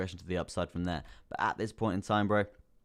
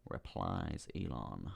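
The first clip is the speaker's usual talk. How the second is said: mock deep voice; 11 semitones lower, 9 dB quieter, much slower than usual. Mock deep voice naming Elon.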